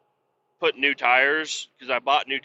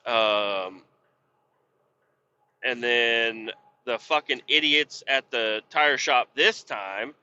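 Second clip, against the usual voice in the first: ordinary talk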